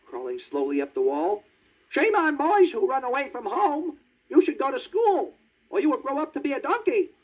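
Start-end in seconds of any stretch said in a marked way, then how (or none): 1.9-7.1 very high, tiny cricket voice; talking cricket. A narrator's reading. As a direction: squeak it like a little cricket. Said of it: "said the talking cricket".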